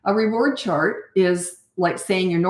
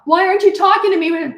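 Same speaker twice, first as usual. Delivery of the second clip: put-on whiny kid; 12 semitones higher, 7 dB louder than usual. Child thrown off by silence.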